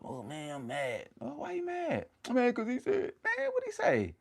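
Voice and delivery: high, squeaky mock complainers; mock griping. Streamer mocking people who stay mad, high mock griping. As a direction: put on a high whiny back-and-forth.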